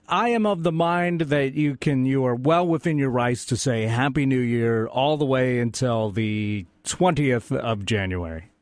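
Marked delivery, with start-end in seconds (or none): none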